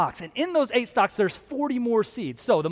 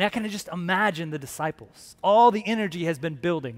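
ordinary talk

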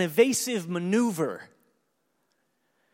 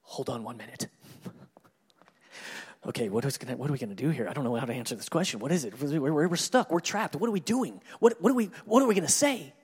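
mock panic; 5 semitones lower, faster than usual. Priests huddling over Jesus' trap question, mock panic building. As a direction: play the cornered priests whispering in a flap, then growing frantic. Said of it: "they're like this".

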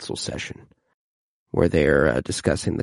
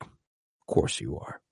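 softer, lower, slow whispered aside; dry Tyrion aside; 7 semitones lower, 10 dB quieter, slower than usual. Retold or imitated. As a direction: breathe it out knowingly under the breath. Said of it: "the way Tyrion's like".

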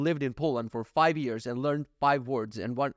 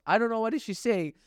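normal talking voice

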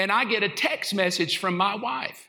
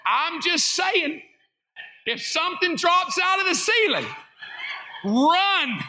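mock warning; 7 semitones higher than usual. Warning the congregation jokingly.

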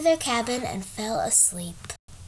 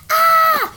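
high shrill scream of fright; a girl screaming as her horse rolls back; 9 dB louder than usual. Acted. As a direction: let out a loud startled scream. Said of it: "she screams".

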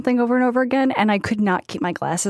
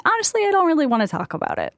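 lightly higher, quick, even brush-off; poker face; 7 semitones higher, faster than usual. Shown as a demonstration. Advising the asker on nosy questions, poker-faced. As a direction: deliver it poker-faced and unbothered. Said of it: "putting on that poker face".

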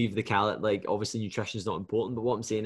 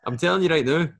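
higher and louder, half laughing; laughing insistence; 7 semitones higher, 5 dB louder than usual. Retold or imitated. Retold insisting to client, laughing.

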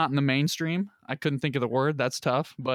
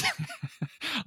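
laughing; 8 semitones higher, 8 dB quieter than usual. Real, not acted. Laughs at own remark.

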